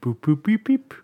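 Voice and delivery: short spoken boops rising into beeps; imitated forklift beeps. Mocking a dull episode of forklift driving, imitated beeps. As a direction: play the machine, beeping along playfully. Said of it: "driving a forklift".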